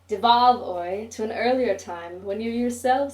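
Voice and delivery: loud taunting call. Acted. Narrator taunting Oi with a loud mock call. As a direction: call him out loudly.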